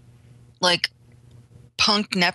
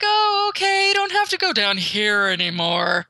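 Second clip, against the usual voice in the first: voice octave going up; 2 semitones higher than usual. Retold or imitated. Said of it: "her voice octave goes up a little bit".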